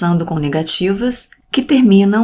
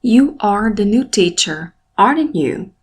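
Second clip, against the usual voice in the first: tag raised on "aren't", dropping on "you"; falling intonation. Shown as a demonstration. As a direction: confirm it, stressing "aren't" and letting "you" fall. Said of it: "Aren't é mais elevado".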